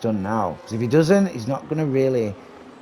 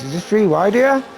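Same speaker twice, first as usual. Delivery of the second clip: excited rising call; 11 semitones higher, 3 dB louder than usual. Real, not acted. Commentator calling a three-wide battle, rising excitement. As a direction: voice climbing high on three wide right now.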